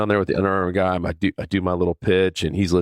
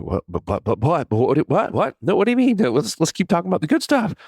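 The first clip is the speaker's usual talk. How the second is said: a hammed-up squeal of alarm at bad news; panicked disbelief; 11 semitones higher than usual. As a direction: voice shooting way up in frantic protest.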